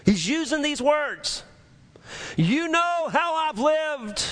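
high, impassioned confession building to a drawn-out cry; full-force preaching. Retold or imitated. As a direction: preach the confession with mounting fervor, stretching the final words.

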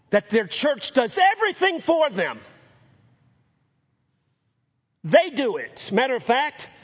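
loud, high preaching cry; impassioned preaching. Real, not acted. Preacher telling congregation about church religion, full force. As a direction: drive the point home from the pulpit.

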